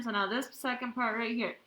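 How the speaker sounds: ordinary talk, nothing marked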